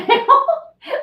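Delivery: high, loud laugh; burst of laughter. Real, not acted. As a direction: break into a big laugh. Laughing after the storm.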